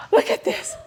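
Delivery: hushed, breathy aside; mock whisper. Visitor whispering to a friend at the museum, mock hushed. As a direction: whisper it like a visitor sharing a find. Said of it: "People come to Bonsai Museum".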